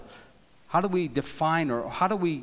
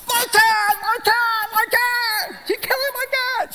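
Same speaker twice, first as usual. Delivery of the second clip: shrill, much higher crying shriek of panic; panicked screaming; 5 dB louder than usual. Retold or imitated. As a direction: scream in terror for the kitten, near tears. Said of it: "She's freaking right out".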